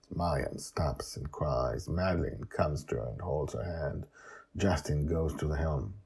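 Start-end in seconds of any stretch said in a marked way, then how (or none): none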